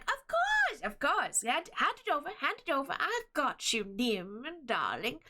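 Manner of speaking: sing-song, swooping little-man voice; jolly gnome. Acted. Gnomish husband offers Nim help, jolly voice. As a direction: fuss happily, pitch leaping up and gliding down. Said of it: "jolly little gnomish man".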